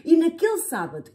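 usual speaking voice

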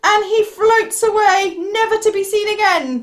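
loud, high storytelling climax; dramatic story narration. A narrator's reading. Big goat tosses troll into the river, triumphant narration. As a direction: proclaim the triumph to young listeners.

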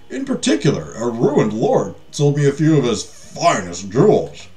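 theatrical merchant making a grand pitch; lightly pompous sales voice for the king; flattering merchant. Acted.

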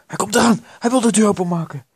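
high, loud, urgent alarm; panicked warning. Acted. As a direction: cry out that danger is at the door. Warns that the ghost is coming.

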